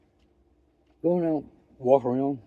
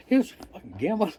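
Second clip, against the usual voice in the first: ordinary talk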